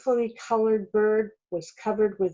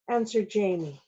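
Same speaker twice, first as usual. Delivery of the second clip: plain reading voice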